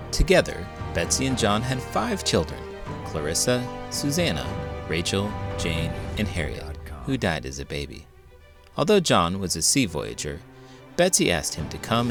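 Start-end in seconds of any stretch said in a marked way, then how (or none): none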